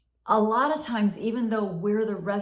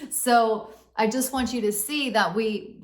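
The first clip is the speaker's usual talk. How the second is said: normal talking voice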